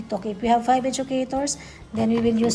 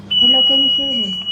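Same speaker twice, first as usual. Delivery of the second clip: louder call into the handset; calling into radio; 9 dB louder, faster than usual. Real, not acted. Testing the walkie-talkie for a listener, calling out louder. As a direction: call out to check the other unit hears you.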